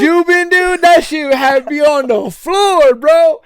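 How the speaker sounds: put-on high, loud, hyped-up young fan; excited fan. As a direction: gush like a starstruck kid. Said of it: "He goes".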